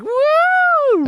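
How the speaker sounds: very high cheering whoop; crowd cheering. Retold or imitated. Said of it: "everybody was like".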